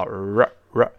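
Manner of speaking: R said with the tongue curled back. Shown as a demonstration. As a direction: curl the tongue up for R. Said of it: "记住要点是要勾舌头".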